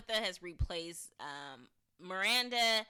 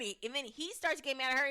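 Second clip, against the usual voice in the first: usual speaking voice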